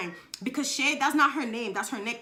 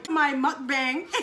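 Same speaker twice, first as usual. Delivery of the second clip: laughing through the words; 3 semitones higher, 5 dB louder, much slower than usual. Real, not acted. Joking with viewers about the mukbang, laughing. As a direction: laugh at your own joke. Laughs at her own joke.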